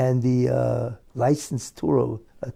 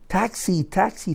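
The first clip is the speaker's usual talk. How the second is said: mock taxi shout; 6 semitones higher than usual. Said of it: "So they're all screaming at me".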